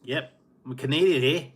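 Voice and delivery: hammed-up Canadian, proud of the new hat; mock Canadian accent. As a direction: play up a jokey Canadian "eh". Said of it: "Now I'm a real Canadian".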